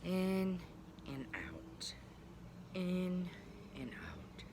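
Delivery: slow breathing demo. Shown as a demonstration. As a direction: guide kids through a slow breath. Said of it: "Breathe very slowly".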